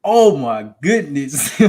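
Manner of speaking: loud, rising exclamation; amazed excitement. Real, not acted. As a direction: build high, then draw out the amazement. Marvelling at her own breakthrough.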